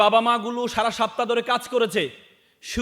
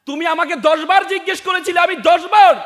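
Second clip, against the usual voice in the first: impassioned reproach; 6 semitones higher, 7 dB louder than usual. Acted. Tearful father reminds his son of childhood questions, impassioned reproach. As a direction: voice raised high and loud, building to a shout.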